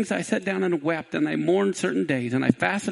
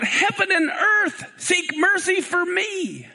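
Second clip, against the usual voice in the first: yelled plea; 10 semitones higher, slower than usual. Acted. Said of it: "Eliezer yelled out".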